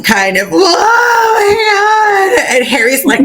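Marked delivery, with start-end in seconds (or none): 0.5-2.6 high, slow hammed-up wail; mock sob it out like a house elf; over-the-top crying cry; mock wailing Dobby. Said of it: "wailing and crying".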